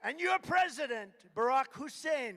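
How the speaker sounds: loud rally name call; mock announcement. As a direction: boom it out like a rally name drop. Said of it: "I'll say".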